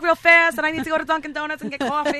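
fast, loud, high rush; excited. Retold or imitated. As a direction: gush about Dunkin' coffee. Craving Jersey home, hammed up.

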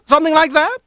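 high, airy rising question; high-pitched needling question; light but biting. Acted. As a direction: toss it out lightly as a pointed fox-lord query. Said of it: "His tone was light".

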